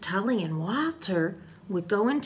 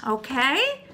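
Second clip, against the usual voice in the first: ordinary delivery, nothing marked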